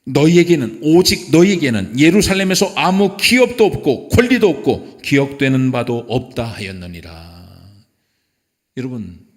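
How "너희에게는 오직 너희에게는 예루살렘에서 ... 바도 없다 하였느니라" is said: ringing pulpit declaration; scripture reading. Acted. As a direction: declaim the verse at full voice. Reads scripture aloud to congregation.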